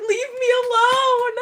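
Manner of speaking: half-shouted laughing plea. Real, not acted. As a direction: beg them to stop while laughing. Begging chat to stop teasing.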